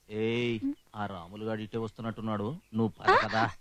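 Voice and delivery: low, very soft undertone; hushed warning. Acted. Man warning his companion someone is coming, hushed. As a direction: drop the voice so the newcomer won't hear.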